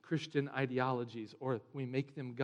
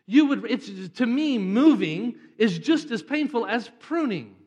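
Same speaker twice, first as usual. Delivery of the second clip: loud, high, emphatic pulpit voice; forceful preaching; 6 semitones higher, 12 dB louder than usual. Real, not acted. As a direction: press the congregation hard.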